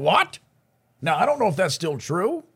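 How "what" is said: squeaky high shocked what; surprised. Retold or imitated. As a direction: react in high disbelief. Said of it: "And I'm like".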